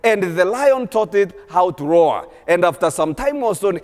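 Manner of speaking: ordinary talk, nothing marked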